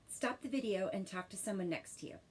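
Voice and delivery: ordinary delivery, nothing marked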